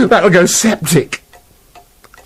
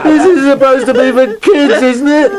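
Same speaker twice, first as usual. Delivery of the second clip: higher, breaking into laughter; laughing in disbelief; 10 semitones higher than usual. Real, not acted. Wolf laughs in disbelief at the cruelty. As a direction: laugh through it, can't believe it.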